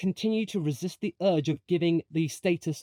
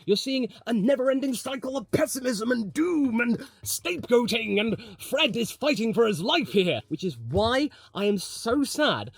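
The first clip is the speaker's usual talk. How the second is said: higher-pitched, hammed-up doom rant; melodramatic lament; 7 semitones higher than usual. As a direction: pile on the catastrophe with theatrical despair. Mock-dramatic rant about Ferrari's doom.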